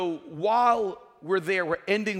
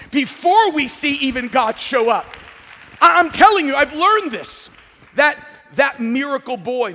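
high, loud impassioned sermon voice; building to a pulpit shout; full-force preaching; 9 semitones higher, 13 dB louder than usual. Real, not acted.

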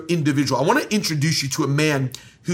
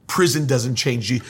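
ordinary delivery

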